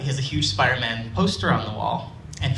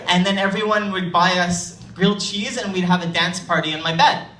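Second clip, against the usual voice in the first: put-on higher, louder, tumbling kid excitement; excited little boy; 7 semitones higher, 4 dB louder than usual. Storyteller voicing Jerry gushing about Spider-Man adventures, higher and louder. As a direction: gush like a thrilled five-year-old.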